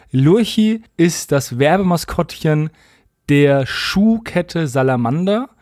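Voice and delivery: normal talking voice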